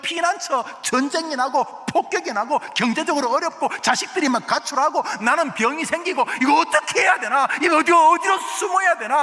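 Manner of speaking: preaching at full force. Acted. Preacher stirring the congregation, impassioned preaching. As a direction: pound the message home to the pews.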